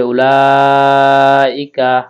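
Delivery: উলাইকা with the la drawn out long and slow; long stretched la. Shown as a demonstration. Teacher slowly models a long vowel for students. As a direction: stretch the vowel of উলাইকা well past a beat. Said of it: "লাটা আমাদেরকে ছাড়ে টেনে পড়তে হবে".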